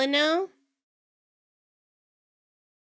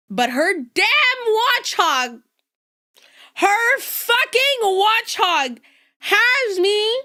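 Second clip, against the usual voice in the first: high, loud, slow furious rant; hammed-up seething shout; mock rage; 10 semitones higher, 6 dB louder, slower than usual. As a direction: rage at the Watchog, overdone for laughs. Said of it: "raging, seething".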